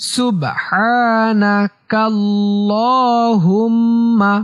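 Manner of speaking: slow, elongated, near-chanted syllables; long held vowels on a steady tone; instructional Arabic recitation. Shown as a demonstration. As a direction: recite phrase by phrase for learners to repeat. Teaches prayer phrase by phrase.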